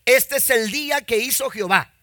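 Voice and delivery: loud, high, fervent sermon delivery; preaching at full force. Real, not acted. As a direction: preach it to the congregation. (predicación fervorosa, fuerte y aguda)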